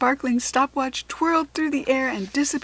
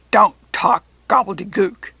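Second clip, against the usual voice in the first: loud, scornful dismissal; lip-curling sneer; 10 dB louder than usual. A narrator's reading. Jack Frost sneers at Rachel, loud and scornful. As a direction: spit it out with a curled lip. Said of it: "with a curling of his lip".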